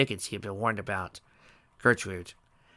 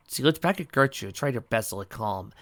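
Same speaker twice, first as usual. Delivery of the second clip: ordinary delivery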